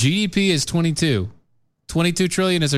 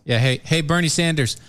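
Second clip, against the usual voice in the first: normal talking voice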